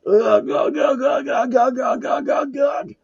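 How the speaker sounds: high repeated choking ughs; monster screaming. A narrator's reading. The beheaded monster screams, high repeated ughs. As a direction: choke out rising grunts as it dies. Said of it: "The monster screams".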